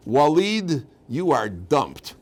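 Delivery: dumping him over the phone, slow and final; decisive dumping. Retold or imitated. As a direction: call his name, then drop the verdict slowly. Bride dumping her boyfriend by phone.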